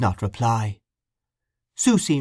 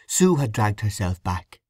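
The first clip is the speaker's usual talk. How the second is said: ordinary delivery, nothing marked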